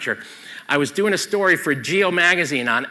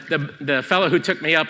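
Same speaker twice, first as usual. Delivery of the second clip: normal talking voice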